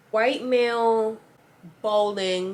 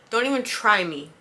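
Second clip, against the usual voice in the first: ordinary talk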